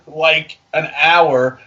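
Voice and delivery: normal talking voice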